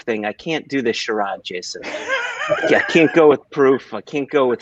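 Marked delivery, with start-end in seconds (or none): 1.8-3.9 high, slow, laughing whine on proof; laughing protest. Real, not acted. Jokingly refusing to do the proof maths, laughing and drawn out. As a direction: stretch it out through a laugh, much higher.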